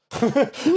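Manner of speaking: a sudden high laugh that breaks out; high burst of laughter. Real, not acted. Laughing at himself while telling friends about the wrestling show.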